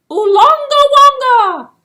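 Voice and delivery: high, loud hopeful shout; high loud guess. Acted. Eland shouting a wrong name at the tree.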